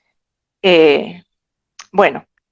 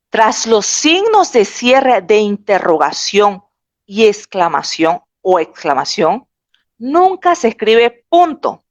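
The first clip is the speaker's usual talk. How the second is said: slow, deliberate, stress on nunca; slow emphatic repetition; slower than usual. Shown as a demonstration. Teacher repeating the rule to students, slow and emphatic. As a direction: spell the rule out slowly, leaning on nunca.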